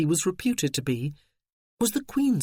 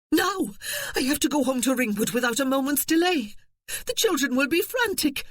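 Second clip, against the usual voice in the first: frantic insistence; 9 semitones higher than usual. Acted.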